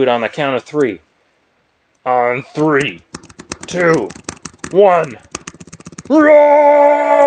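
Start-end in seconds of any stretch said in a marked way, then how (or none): 6.1-7.2 big high loud roar; roaring booming lion. Shown as a demonstration. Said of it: "make a big roar".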